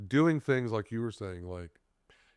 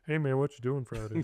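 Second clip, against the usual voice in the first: higher put-on phone voice with a laugh; 5 semitones higher than usual. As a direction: answer the phone in your telephone voice. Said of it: "That's my phone voice".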